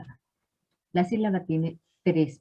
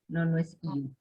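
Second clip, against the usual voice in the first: ordinary talk, nothing marked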